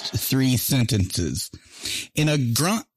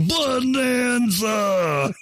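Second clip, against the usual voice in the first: Bonanza shouted high, very slow; booming announcer Bonanza; monster truck ad; 8 semitones higher, much slower than usual. Pretend. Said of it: "monster truck advert".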